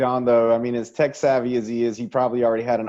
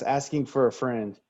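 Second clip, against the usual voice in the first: usual speaking voice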